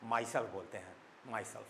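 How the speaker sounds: low, soft Indian English "myself". Shown as a demonstration. Teacher showing students the everyday way, "myself" in plain Indian English. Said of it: "जो Indian English है या जिसको English बोलते हैं".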